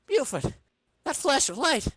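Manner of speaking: excited character voice. Acted. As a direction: point it out to Buford, keyed up. Comic character calling his partner.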